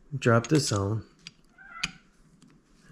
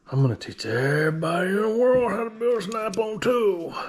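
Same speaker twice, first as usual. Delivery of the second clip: high, put-on voice for a big dream; grand inner voice; 9 semitones higher than usual. Narrating his own big plan in a high, put-on voice. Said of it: "I thought, "Well".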